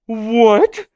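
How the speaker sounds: high loud stunned cry; shocked shout. Real, not acted. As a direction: yell it in disbelief. Shock reveal reaction.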